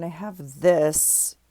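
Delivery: ordinary talk, nothing marked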